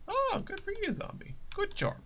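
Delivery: high mocking good for you; sarcastic praise. Real, not acted. Mocking a chatter in a high sarcastic voice. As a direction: tease the chatter with fake praise.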